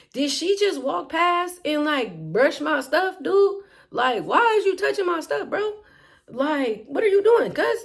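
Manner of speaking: offended, who touched my stuff; irritated disbelief. Retold or imitated. Irritated inner voice about brush.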